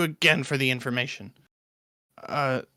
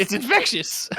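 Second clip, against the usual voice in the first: laughing; 5 semitones higher than usual. Real, not acted. Laughing remark.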